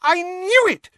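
very high, loud squeal; excited. A narrator's reading. Hermione spotting the badge in Harry's hand, excited. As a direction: shriek it in excitement. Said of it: "she said excitedly".

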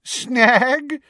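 loud, high, incredulous echo; vibrating with joy. A narrator's reading. Minister dismissing the headmaster's objection, joyfully. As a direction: repeat it loudly, still elated. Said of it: "his voice still vibrating with joy".

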